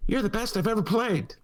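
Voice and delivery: high-pitched put-on voice of a girl chess genius; imitation of Beth. Orphan chess genius tells her Russian opponent, high-pitched imitation. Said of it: "she says to him ... That's my imitation".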